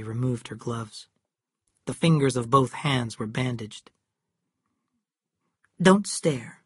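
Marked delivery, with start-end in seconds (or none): 5.8-6.6 angry. A narrator's reading. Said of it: "she said angrily".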